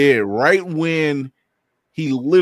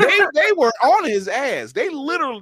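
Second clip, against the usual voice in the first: much higher, excited telling. Real, not acted. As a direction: pitch jumps way up with excitement. Excited retelling.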